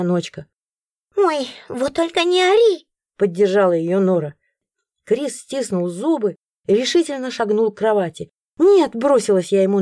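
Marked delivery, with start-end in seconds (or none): 1.2-2.9 high, cheeky retort; sassy creature. A narrator's reading. Nora backing the cat, high sassy. 8.6-8.9 loud high no; cry out. A narrator's reading. Heroine stopping Chris, loud cry.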